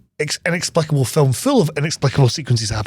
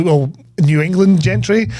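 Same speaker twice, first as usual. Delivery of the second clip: usual speaking voice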